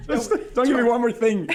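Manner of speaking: laughing retelling. Retold or imitated. Priest quoting sister's last joke, laughing. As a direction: giggle the line out.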